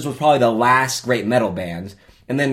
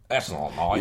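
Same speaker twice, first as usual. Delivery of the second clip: lower, softer mock Australian; Australian accent; 6 semitones lower, 8 dB quieter than usual. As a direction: drop low into an imitated Australian accent. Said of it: "Terrible, terrible Australian".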